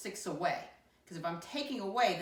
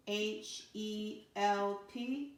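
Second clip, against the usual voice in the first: slow spelling; 3 semitones higher, much slower than usual. Shown as a demonstration. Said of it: "How do I spell help?".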